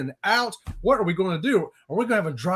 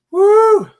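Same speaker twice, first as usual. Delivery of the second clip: loud, high "woo" cheer; excited whoop; 13 semitones higher, 14 dB louder than usual. Real, not acted. Cheering on the group cruise, loud whoop. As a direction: let out a big high whoop.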